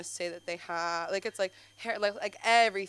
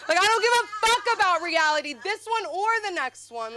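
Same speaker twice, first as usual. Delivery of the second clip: worked-up rant; 11 semitones higher, 6 dB louder than usual. Real, not acted. Ranting about reality.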